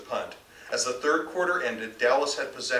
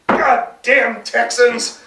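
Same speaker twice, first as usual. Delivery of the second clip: loud, high, slow furious curse; angry yell; 10 semitones higher, 12 dB louder, much slower than usual. A narrator's reading. Fan cursing the rival team at the TV, loud and slow. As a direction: spit out the curse with rage. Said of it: "Chester yelled".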